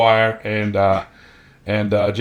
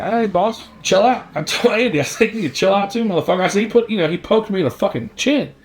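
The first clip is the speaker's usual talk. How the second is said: high-pitched retold back-talk; worked-up, pitched-up pleading of his case; indignant protest; 9 semitones higher than usual. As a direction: act out a teenager protesting his innocence. Cocky teen protests, retold.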